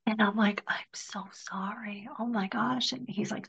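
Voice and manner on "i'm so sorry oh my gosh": hushed, breathy-soft I'm so sorry; whispered sympathy. Retold or imitated. Speaker consoling the grieving father, in a hushed whisper. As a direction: whisper it gently, not wanting to interrupt.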